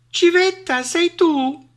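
high, piping hail; mouse greeting. A narrator's reading. Mouse greets the owl, piping up. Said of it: "la salutò il topo".

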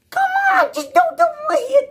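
way up high, begging; high-pitched plea. Real, not acted. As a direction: squeak out the plea. Pleading with Sony.

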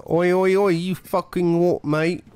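high-pitched mock British lout; hammed-up British "oi, mate" challenge; terrible British accent. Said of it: "really terrible British accent".

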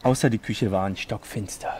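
low, hushed storyteller reading; serious bedtime-story narration. Acted. Reads fanfiction aloud.

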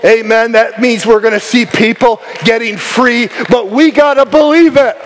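high-energy sermon, ringing out promises; fervent preaching. Real, not acted. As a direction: preach it with rising conviction and punched key words. Preaches at full force.

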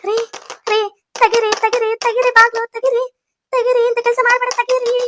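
high, insistent begging; desperate pleading. Acted. Wife begs husband to open the door, desperate pleading.

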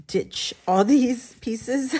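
loud, slow word breaking into laughter; drawn out, then giggling; laughing admission. Real, not acted. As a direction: laugh at your own wasted effort. Laughs at scrapping her own work.

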